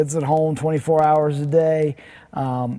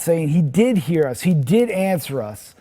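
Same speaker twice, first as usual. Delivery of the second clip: usual speaking voice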